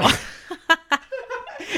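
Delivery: a burst of high, breathy laughter. Real, not acted. She laughs at her own story's punchline, high laughter.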